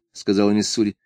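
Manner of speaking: plain reading voice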